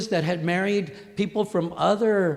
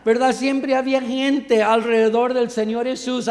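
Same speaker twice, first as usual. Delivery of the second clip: usual speaking voice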